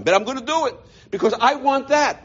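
ordinary talk

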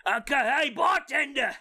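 loud, slurred barfly shout; drunk bar patron. Pretend. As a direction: holler for beers like a drunk. Drunk patron calls bartender.

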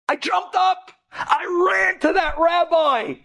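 high, slow, exultant cry; dramatic, stretched-out exclamation; impassioned outburst. Retold or imitated. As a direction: call it out high at the story's climax. Climax of retold awakening.

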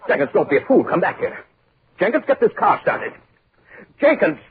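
high shout after someone walking away; desperate call. Acted. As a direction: yell into the dark road. Calling after the departing chauffeur.

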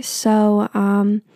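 ordinary talk

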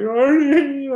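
high, loud mock bawl; tearful wail. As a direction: cry out to the coach in an exaggerated high wail. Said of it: "I'm crying".

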